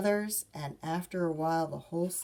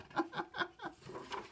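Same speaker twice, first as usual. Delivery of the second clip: breathy laugh; 8 dB quieter than usual. Real, not acted. Reader laughs after Papa.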